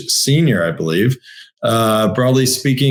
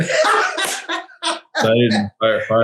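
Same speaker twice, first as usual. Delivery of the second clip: laughing; much slower than usual. Real, not acted. Host cracks up at the story.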